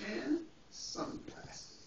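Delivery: hushed breathy reveal; whispered with delight. Acted. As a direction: breathe the words out like a secret. Whispered showing accessories.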